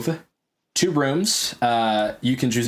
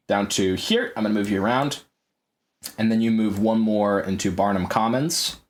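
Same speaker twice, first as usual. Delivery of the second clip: ordinary talk, nothing marked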